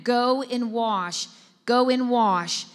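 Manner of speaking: slow, deliberate go and wash; slow emphatic. Shown as a demonstration. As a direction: go and wash drawn out slowly. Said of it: "we see in that".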